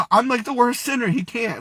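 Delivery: high, overwhelmed disbelief; tearful protest. Retold or imitated. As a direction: protest as if close to tears. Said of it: "really bawling my eyes out at this point".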